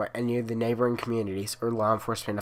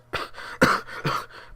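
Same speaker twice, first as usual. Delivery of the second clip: cough. Acted. Caller coughs before speaking.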